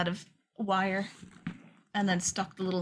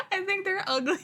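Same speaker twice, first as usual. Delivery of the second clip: high giggle around the words; laughing; 11 semitones higher than usual. Real, not acted. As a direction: laugh it out, can't keep a straight face. Laughing at own dislike.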